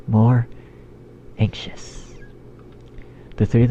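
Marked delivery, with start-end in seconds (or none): 1.4-2.1 whispered. A narrator's reading. Narrator whispers word.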